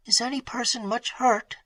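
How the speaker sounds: high, soft, fearful question; tremulous. A narrator's reading. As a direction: ask fearing the answer. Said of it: "said Emily in a tremulous voice".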